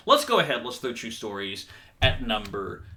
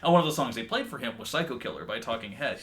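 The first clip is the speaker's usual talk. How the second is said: ordinary delivery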